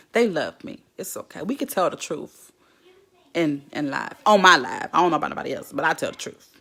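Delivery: ordinary talk, nothing marked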